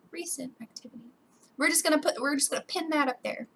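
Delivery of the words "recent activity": hushed, whispered aside; barely audible murmur; whispered repeat. Real, not acted. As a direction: whisper it to yourself. Repeats the email's words quietly.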